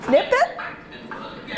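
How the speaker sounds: squeaky high whisper; high squeak. Acted. Chant, high unvoiced voice.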